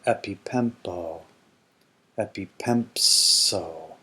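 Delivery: slow deliberate recitation; slow principal parts. Shown as a demonstration. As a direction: recite the forms slowly for the class. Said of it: "reads principal parts".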